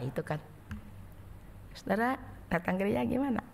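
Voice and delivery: ordinary talk, nothing marked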